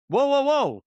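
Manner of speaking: high-pitched, heated objection; yelling in protest. Real, not acted. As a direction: cut in hard, voice jumping up. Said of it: "'cause you're yelling at me".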